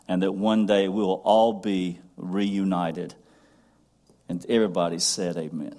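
low, hushed prayer voice. Acted. Pastor praying for the congregation, hushed and low.

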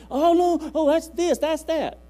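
high, loud squeaky mock voice; a hammed-up squeal of dismay; squeaky mock tree hugger. Mimicking a fussy tree hugger, high and squeaky.

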